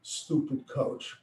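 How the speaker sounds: soft, slow, breathy mutter; muttered under breath. Retold or imitated. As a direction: grumble it so only first base hears. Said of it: "He mumbles under his breath".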